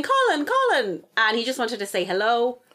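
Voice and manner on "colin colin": high, drawn-out calling of a name; mock shout, much higher pitch; imitated shouting. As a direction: call out like an excited stranger. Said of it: "a lady with curly hair started shouting".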